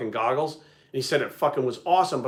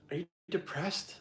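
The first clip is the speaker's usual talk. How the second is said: gentle worried question; 3 semitones higher, 12 dB quieter, slower than usual. Retold or imitated. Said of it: "She goes".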